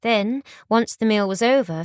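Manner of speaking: ordinary delivery, nothing marked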